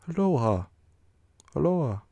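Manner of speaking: loud, high call of many voices; distant calling. A narrator's reading. Narrator voicing far-off riders calling in unison, loud high call. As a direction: call out across the dark.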